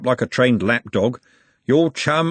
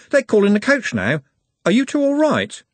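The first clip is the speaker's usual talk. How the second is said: high, louder call; a lad's higher calling voice; Trev calling; 12 semitones higher, 4 dB louder than usual. A narrator's reading. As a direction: call over to them cheerfully.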